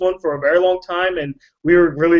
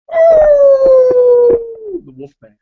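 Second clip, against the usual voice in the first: the Wolfman howled out long and high; 7 dB louder, much slower than usual. Pretend. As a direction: stretch it into a loud werewolf howl. Announcing the Wolfman with a howl.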